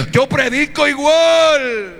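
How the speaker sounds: slow, high, shouted declaration; emphatic shout. Real, not acted. Preacher shouting to the congregation that he preaches the same. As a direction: belt it out slowly and firmly.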